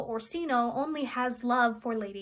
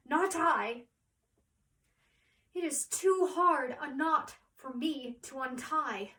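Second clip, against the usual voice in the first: higher-pitched Shakespearean soliloquy with long pauses; Viola, troubled and musing; 4 semitones higher than usual. Acted. Viola alone, realising Olivia loves her disguise, troubled musing. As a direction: play a lovestruck woman puzzling out her predicament.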